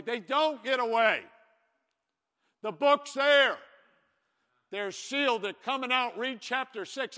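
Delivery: loud, high preacher's cry; preaching at full force. Acted. Pastor rousing the church about coming judgment, shouting. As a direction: build the sermon to a ringing shout.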